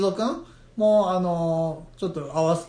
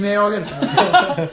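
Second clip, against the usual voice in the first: laughing; 3 semitones higher, 6 dB louder, slower than usual. Real, not acted. Jokes he'd scream at own creature.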